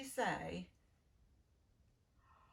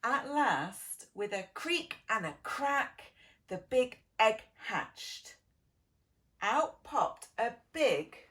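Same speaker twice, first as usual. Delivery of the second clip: louder and slowed, building the reveal; dramatic storytelling; 7 dB louder, slower than usual. A narrator's reading. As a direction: make the big hatching an event. Dramatic big egg hatching.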